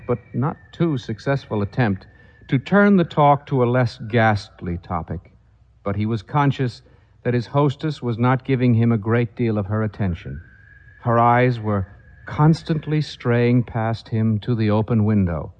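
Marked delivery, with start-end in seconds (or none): none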